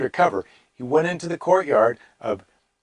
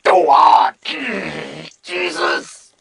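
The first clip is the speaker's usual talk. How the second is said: mock breakdown; 5 semitones higher, 6 dB louder, much slower than usual. Acts out Peter breaking down.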